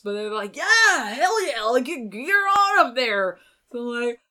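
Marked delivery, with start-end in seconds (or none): 0.6-3.4 loud, higher crowd encouragement; acted-out rowdy approval; enthusiastic cheering on. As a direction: urge someone up with mock crowd gusto. Crowd cheering her to the front.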